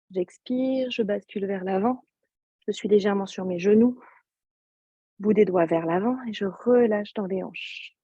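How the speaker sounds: ordinary talk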